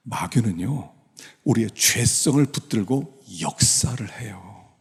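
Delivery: ordinary talk, nothing marked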